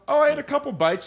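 high, light and casual; breezy normal person. Retold or imitated. Mimicking how normal people eat cake, high and breezy. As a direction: toss it off like it's nothing. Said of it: "they just go".

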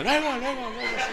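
high, quick, dismissive "luego"; mock brush-off. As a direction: shoo him away lightly. Ellas lo despachan. (despachar burlón, agudo)

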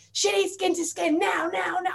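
high, urgent mock orders; sergeant major. Mother mimics partner insisting on skin to skin, sergeant major. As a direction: imitate a pushy partner, insisting at high pitch. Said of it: "the Sergeant Major".

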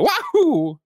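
high, loud Mario cry of joy. Retold or imitated. Mimicking Mario's famous cheer for the co-host. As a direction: yell it like Mario jumping. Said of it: "the voice of Mario".

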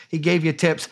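ordinary talk

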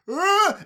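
high, loud mock scream waking up; startled scream. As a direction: jolt awake with a yelp. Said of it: "And he's like".

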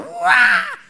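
very high, shouted squeal of joy; excited wow. Retold or imitated. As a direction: burst out with a thrilled, high-pitched wow. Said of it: "yo estaba así emocionado". (¡Guau! emocionado, muy agudo y gritado)

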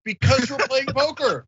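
high, obvious-answer tone; 理所当然地高声回答; matter-of-fact. Retold or imitated. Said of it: "And I was like".

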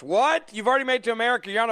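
high incredulous what; sarcastic disbelief; mock shock. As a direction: fake astonished squeak. Mock shocked reaction to news.

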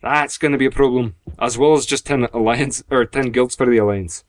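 put-on Scottish with rolled R's; Scottish accent. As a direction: keep up the Scottish act. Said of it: "did the whole rolling my R's thing".

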